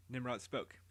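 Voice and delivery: usual speaking voice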